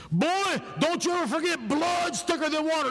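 high, forceful scolding as his father; imitated stern father. Preacher imitating his father demanding family attendance, stern scolding. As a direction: lay down the law like an insistent dad. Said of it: "I heard my father say this".

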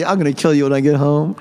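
a hint of a smiling threat through the teeth; through gritted teeth. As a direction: smile while hissing the threat. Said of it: "I was smiling, you know, through my teeth".

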